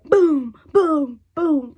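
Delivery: loud high bursts; excited boom. Real, not acted. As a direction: shout each boom. Excited reveal of finished brow.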